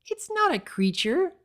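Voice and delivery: high-voiced weak excuse; lame excuse. A narrator's reading. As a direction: trail off, unconvinced. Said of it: "she said lamely".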